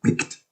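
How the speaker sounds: blickt spat out short and sharp. Shown as a demonstration. Lecturer demonstrating the choir's accent, blickt short and sharp. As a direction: snap the word blickt off crisp and short. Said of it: "so it's very sharp".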